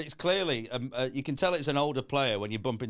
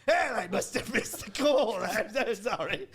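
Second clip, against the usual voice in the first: slow apology broken by a laugh; awkward laughing; 8 semitones higher, slower than usual. Retold or imitated. Kid apologising on a prank call, slow stifled laughing.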